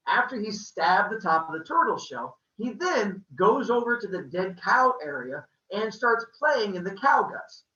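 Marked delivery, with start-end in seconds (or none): none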